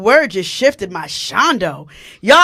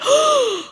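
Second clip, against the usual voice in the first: gasp; 14 semitones higher than usual. Retold or imitated. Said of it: "I was like".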